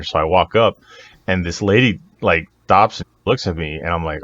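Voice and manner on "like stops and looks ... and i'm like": ordinary delivery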